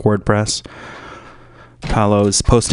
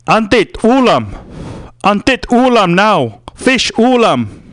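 dad's voice; 7 semitones higher, slower than usual. Retold or imitated. Dad voiced in a story.

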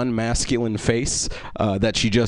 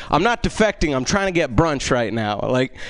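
normal talking voice

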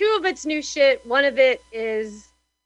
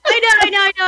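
flustered; 8 semitones higher, 6 dB louder, much faster than usual. Real, not acted. Caught unmuted, embarrassed.

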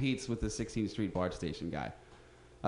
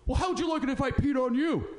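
indignant owner; 11 semitones higher than usual. Retold or imitated. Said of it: "He's just like".

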